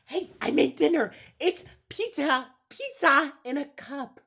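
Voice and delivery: high, loud, chirpy fake host voice; mock cheerful host. Pretend cook proudly serving pizza in a cup, high and chirpy.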